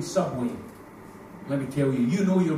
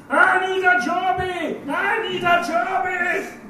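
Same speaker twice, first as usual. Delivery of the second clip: put-on slow, much higher shouting toddler; toddler yelling; 13 semitones higher, 6 dB louder, much slower than usual. Little girl shouting in the pub restaurant, high slow yell. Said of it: "all of a sudden it was".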